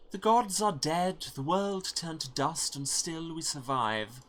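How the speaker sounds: slow solemn saga hero; solemn character voice. Acted. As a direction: proclaim survival over ruin. Voicing a grave character.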